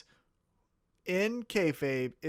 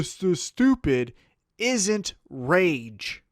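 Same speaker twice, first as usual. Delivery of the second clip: ordinary delivery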